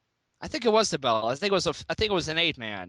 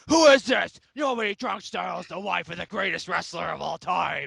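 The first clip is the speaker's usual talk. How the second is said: Triple H impression; 3 dB louder than usual. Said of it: "answer and say".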